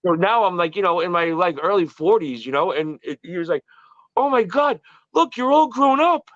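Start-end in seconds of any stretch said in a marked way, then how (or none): 4.2-6.3 high, drawn-out retold marvelling; sincere amazement. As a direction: imitate a delighted old acquaintance. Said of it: "it was so sincere".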